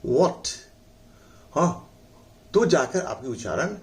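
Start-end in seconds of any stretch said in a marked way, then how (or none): 0.1-0.5 what said with rounded lips. Shown as a demonstration. Teacher demonstrating lip rounding. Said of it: "lip को गोल करके बोलना".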